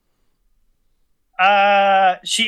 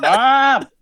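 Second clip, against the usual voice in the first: high, loud, drawn-out call for mom; yelling; 8 semitones higher, 6 dB louder than usual. Retold or imitated. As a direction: mimic a kid hollering for his mother. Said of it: "He just keeps yelling".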